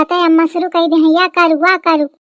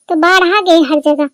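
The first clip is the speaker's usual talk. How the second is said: ordinary talk, nothing marked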